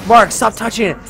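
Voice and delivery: mild mock scream. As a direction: imitate a screaming brother lightly. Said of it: "he started screaming".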